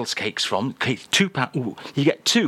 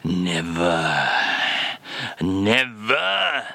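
hammed-up French accent, lightly lower; mock French accent; 7 semitones lower, much slower than usual. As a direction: play the French crooner in a comic French accent. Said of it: "Is this a phrase you've used yourself".

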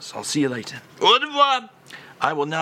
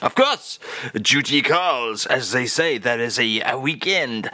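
mock French accent. [name] answers the host about the supermarket, in a mock French accent. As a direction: toss it off like a jaunty Frenchman.

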